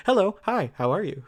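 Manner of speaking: high, loud, over-eager greeting; ingratiating. Acted. Poe trying to get in her good graces, over-eager. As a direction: greet brightly as if begging to be liked. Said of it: "trying to get in her good graces".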